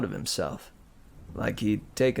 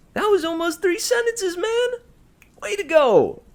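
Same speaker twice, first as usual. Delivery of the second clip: very high, loud mock praise; mock cheer; 7 dB louder than usual. A narrator's reading. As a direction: cheer him on sarcastically. Leo mock-cheers Cal.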